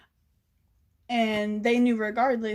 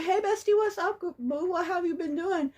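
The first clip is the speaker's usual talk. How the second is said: sugary fake-friendly greeting; 7 semitones higher than usual. Sweet-talking ex-friend after blocking.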